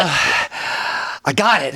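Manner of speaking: slow words between mock pants; catching his breath. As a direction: imitate a winded diver, panting between words. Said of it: "trying to catch his breath".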